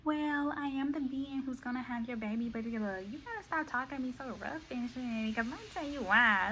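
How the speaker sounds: soft high-voiced lady; prim, quiet retort; lightly voiced proud woman; woman's reply.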